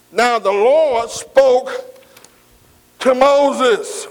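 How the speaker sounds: slow, weighty reading of scripture. Acted. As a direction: read it out slowly like holy writ. Reads scripture slowly.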